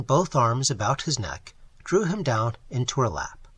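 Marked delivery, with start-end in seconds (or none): none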